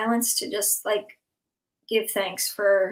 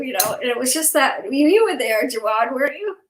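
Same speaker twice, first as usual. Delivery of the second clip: ordinary delivery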